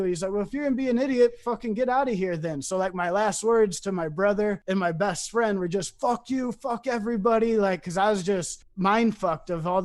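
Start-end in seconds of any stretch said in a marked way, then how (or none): 0.3-2.6 quick, higher-pitched imitated telling-off; retold exasperation. Friend throwing out his drunk guest, mildly angry. 6.0-7.6 higher-pitched, half-shouted defiance; retold angry curse. His last words to brother and best friend, mildly angry.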